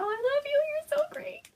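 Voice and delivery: gushing squeal. Real, not acted. Thanking Gail on camera, a high gushing squeal. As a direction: squeal your love to a friend.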